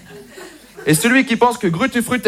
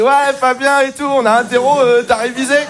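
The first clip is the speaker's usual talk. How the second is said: schoolboy chatter; 5 semitones higher, 3 dB louder than usual. Retold or imitated. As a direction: imitate pupils fretting before a test, voice pitched up. Schoolboys calling out before a test. (écolier qui interpelle, voix haut perchée et traînante)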